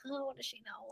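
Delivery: hushed, dismissive mock whisper; very soft retold grumble; whispered aside. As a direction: whisper it like a private dig at mom. Retold dismissive aside about mom.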